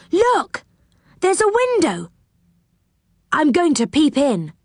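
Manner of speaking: high-pitched, eager discovery; excited calling. A narrator's reading. Rick tells the others about the window, high excited call. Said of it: "he called to the others".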